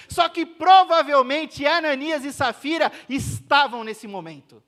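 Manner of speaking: loud, driving sermon line; loud preacher's emphasis; emphatic preaching. Real, not acted. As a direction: push it out to the congregation. Preacher raising intensity.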